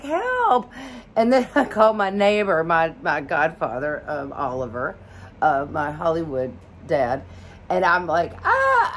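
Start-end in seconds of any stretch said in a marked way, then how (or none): 0.0-0.7 high, squeaky plea for help; retold panicked help call; mock cry for help. 8.4-8.9 high panicked squeal; a retold panic scream, shrill and high; freaking out. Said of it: "I'm, like, freaking out".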